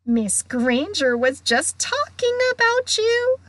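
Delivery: high, insinuating tease; sly. A narrator's reading. Poltergeist telling on girl to ghost, slyly. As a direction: stir up trouble with mock innocence. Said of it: "said Peeves slyly".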